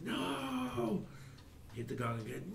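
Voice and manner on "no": a sudden high-pitched "No!" from the giant creature; the monster shrieks its denial; high anguished cry. Acted. Giant figure crying out as the gong is struck. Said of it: "and the figure's like".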